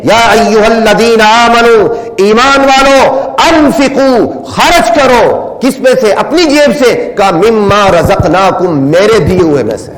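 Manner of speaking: high, slow, ringing call to the believers; fervent preaching. Real, not acted. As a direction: proclaim it slowly at full force. Preacher voicing God's command. (اونچی، دھیمی اور پرزور آواز میں اعلان)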